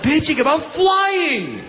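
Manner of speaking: mock-furious rant; indignant shout. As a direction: berate the whiner. Ranting at complainers.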